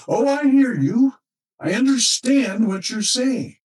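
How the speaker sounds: husband brushing her off, high and quick; quick reassurance. Retold or imitated. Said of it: "And he immediately said".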